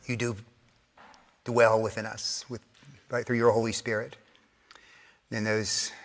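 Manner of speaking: soft, low, unhurried prayer; quiet prayer. Acted. Preacher leading the congregation in closing prayer, softly. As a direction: pray it gently and reverently.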